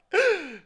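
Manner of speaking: sharp offended gasp; shocked gasp. Acted. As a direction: gasp in outrage. Boss reacts to hidden money.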